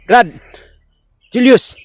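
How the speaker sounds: shouting his name across; loud urgent call. Real, not acted. Calling out to a possessed friend.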